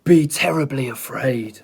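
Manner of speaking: loud rumbling lion threat; storybook lion roaring again. Acted. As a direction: boom the threat down at them. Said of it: "he roared".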